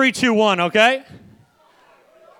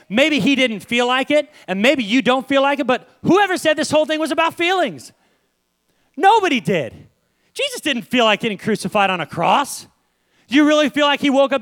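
raised, forceful preacher voice; impassioned preaching; 6 semitones higher, 4 dB louder than usual. Acted. Preacher challenging church about following feelings, impassioned. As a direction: preach it hard, pitch lifted.

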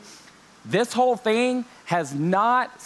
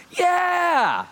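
a much higher, louder, squealing yeah; toddler's scream; 14 semitones higher, 3 dB louder than usual. Retold or imitated. Dad imitating his daughter screaming over food, high and loud. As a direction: shriek it like an excited two-year-old. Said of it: "She screamed".

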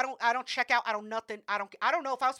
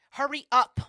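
louder annoyed snap; snapping; 4 semitones higher, 10 dB louder than usual. Retold or imitated. Pissed patient snapping at the phlebotomist to hurry. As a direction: bite it off, impatient.

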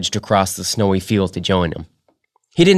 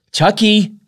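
high, loud stern call of the name; mother firmly calling her son; 5 dB louder than usual. Acted. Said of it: "she said sternly".

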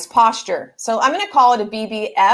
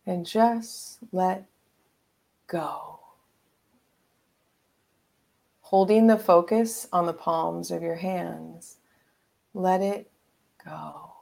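guided meditation; 3 semitones lower, 4 dB quieter than usual. Acted.

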